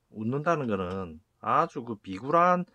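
usual speaking voice

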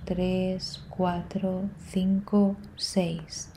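slow, soft breath-counting with long pauses; calmly pace the listener's breath, letting each count fade; guided breathing meditation. Real, not acted.

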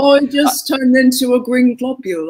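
mock English accent. Host quoting Spinal Tap to amuse guest, mock English accent. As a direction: do a bewildered English rocker.

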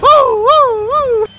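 very high, loud whoops; high whooping. Real, not acted. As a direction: whoop it up wildly. Cheering whoop.

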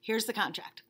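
usual speaking voice

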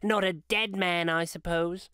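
high, cackling woman's voice; a thief dismissing guilt to Mrs Dilber; brash old woman. A narrator's reading. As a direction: toss it off like a hard old thief.

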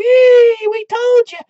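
mocking gleeful economists. Economists jumping up and down, hammed-up high squeal. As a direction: squeal it like a gloating know-it-all.

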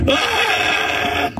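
short high cry of despair; anguished high yell. Real, not acted. Man despairing over his haircut, anguished yell. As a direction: let out a wordless wail.

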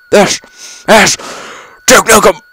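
yelled high and loud; battle cry. Real, not acted. Gamer charging an enemy with a yelled battle cry. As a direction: shout it as you charge in.

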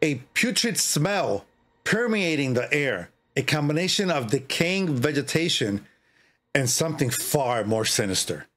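dramatic, slow horror-story reading; story narration. A narrator's reading. Streamer reading a horror story to viewers, spooky narration. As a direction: read it like a spooky campfire tale.

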